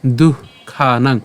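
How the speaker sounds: দুঃখানাং said very slowly with a breathy h after দু; দুঃখানাং with breathed visarga, slow. Shown as a demonstration. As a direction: let air out on দুঃ then খানাং slowly. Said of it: "সেজন্য উচ্চারণটা হবে কেমন?".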